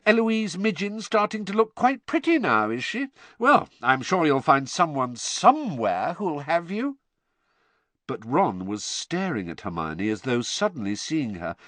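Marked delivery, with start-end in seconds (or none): 0.1-7.0 put-on high-pitched, condescending girl; mock sympathy, pitched high and superior; loftily. Hermione taunting Ron about finding a partner, loftily. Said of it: "said Hermione loftily".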